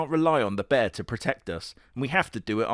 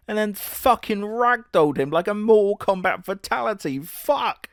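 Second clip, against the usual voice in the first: real excitement; 6 semitones higher than usual. Real, not acted. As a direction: erupt in genuine hype at the fight. Reacting to fight scene.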